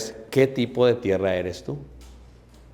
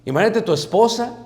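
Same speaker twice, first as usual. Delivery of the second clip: ordinary delivery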